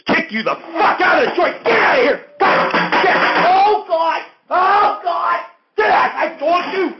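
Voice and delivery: screaming high and loud, throwing him out; furious shout. Acted. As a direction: blow your top and yell him out the door. Host loses temper, throws guest out.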